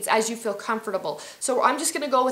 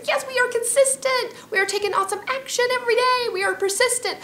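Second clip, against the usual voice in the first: much higher and louder, whooping with a laugh; jubilant little voice; 6 dB louder than usual. Acted. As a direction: cheer yourself on like a thrilled cheerleader. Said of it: "my little voice is like".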